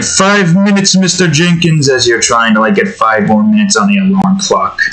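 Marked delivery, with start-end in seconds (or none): 0.0-1.9 a lighter, higher pleading echo; groggy sleeper. Retold or imitated. Recalling the alarm-clock plea, lightly higher. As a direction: lightly replay the snooze plea. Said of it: "It's like".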